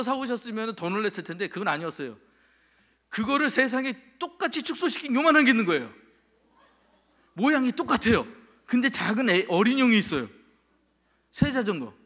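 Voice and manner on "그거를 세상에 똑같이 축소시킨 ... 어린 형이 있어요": excited amazement. Retold or imitated. Amazed by the tiny bicycle.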